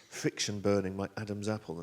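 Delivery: usual speaking voice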